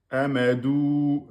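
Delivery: Amadou with the final ou held for two beats, slowly; reading Amadou slowly for a class, long ou held. Shown as a demonstration. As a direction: stretch the ou in Amadou as you teach. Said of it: "on a bien le deux temps".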